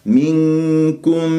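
منكم with a nasal nun before the kaf; hidden nun. Shown as a demonstration. Teacher reciting the word slowly. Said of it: "ورققت الكاف وميم مظهرة".

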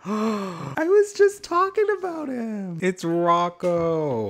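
high, slow gasping disbelief; stunned surprise. Real, not acted. To the viewers on spotting Rocco, high, slow stunned surprise. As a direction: squeal it out, caught off guard. Gasps seeing villager just mentioned.

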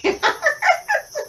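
a high burst of laughter; bright giggle after the joke. Real, not acted.

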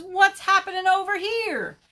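high, loud storybook bear; polar bear arriving. Acted. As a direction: boom out a high, nosy question.

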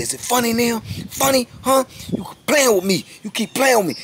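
the big sergeant mocking the boy while choking him; sergeant's menace. Sergeant taunting the choking young inmate, high and menacing. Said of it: "He says".

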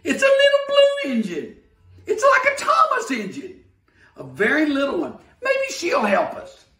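very high, loud excited clown shout; excited calling out. Acted. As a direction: holler with glee at the new engine. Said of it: "the little clown called out".

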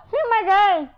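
loud, high exclamation; excited shout. Real, not acted. Player reacting to the play, excited shout. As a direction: yell it in amazement.

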